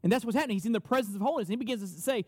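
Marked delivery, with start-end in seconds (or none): none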